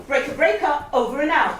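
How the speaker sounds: radio call, loud and clipped; walkie-talkie voice. Pretend. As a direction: talk like over a radio. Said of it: "pretend you are speaking to the safety officer".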